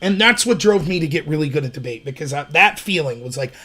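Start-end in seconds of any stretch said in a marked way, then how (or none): none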